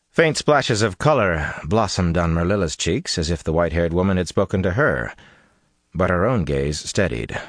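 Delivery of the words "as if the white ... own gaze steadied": ordinary delivery, nothing marked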